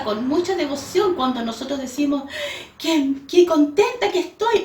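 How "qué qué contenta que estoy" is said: higher and louder, bursting with joy; joyful exclamation. Shown as a demonstration. Preacher demonstrating how joy sounds, excited and higher. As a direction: exclaim it like happy news. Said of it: "uno lo dice con, en la entonación".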